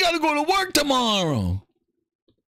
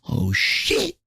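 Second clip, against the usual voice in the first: ordinary delivery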